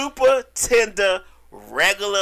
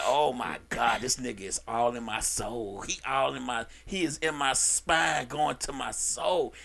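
lower, softer, slowing into a moan; mock swooning client; 9 semitones lower, 9 dB quieter than usual. Client on the table, lightly voiced swooning moan. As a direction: melt into it like the massage hits your soul.

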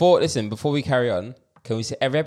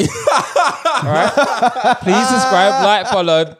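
much higher, louder, laughing mock plea; 7 semitones higher, 5 dB louder, much slower than usual. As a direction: beg the Kremlin to subscribe, half-laughing. Begging Putin on mic, laughing.

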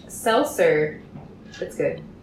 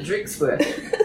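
fancy butler. Pretend. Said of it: "That's my butler bartender".

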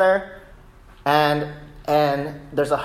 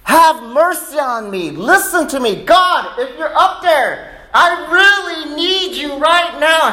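high, impassioned cry to God; desperate prayer; 11 semitones higher, 4 dB louder than usual. Acted. Praying on hands and knees to God, desperate high shout. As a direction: shout the plea, stretching into a drawn-out wail. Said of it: "You really are desperate".

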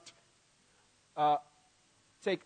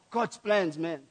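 usual speaking voice

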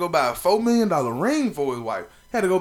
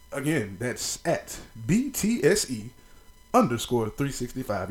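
radio voice; 5 semitones lower, 5 dB quieter than usual. Shown as a demonstration. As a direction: read it like a college radio DJ. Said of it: "Y'all like that radio voice?".

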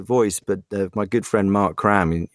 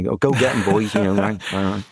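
West Country accent. Shown as a demonstration. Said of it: "that don't really do Captain Miller".

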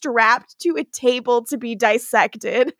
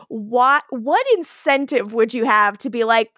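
ordinary talk

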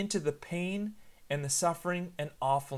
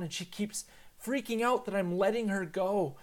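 usual speaking voice

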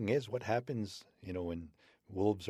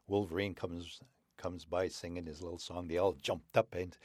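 ordinary delivery

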